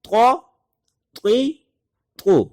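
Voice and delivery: sing-song teacher drill, pitch up; syllables called out high for students to repeat; vowel drill. Shown as a demonstration. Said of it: "دوبارہ اس کی practice".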